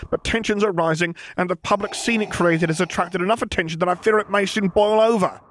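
nervous officer voice. Acted. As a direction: answer like a jumpy subordinate. Officer reports in role.

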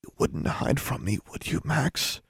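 soft, whispery plea rising at the end; barely forced out. A narrator's reading. Girl begging a possible ghost to answer, barely audible. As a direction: squeeze the words out very quietly. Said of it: "could barely force more words out".

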